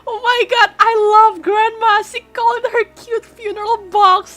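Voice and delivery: high, loud laughing gush; laughing delight. Real, not acted. Delighted by grandma's funeral box.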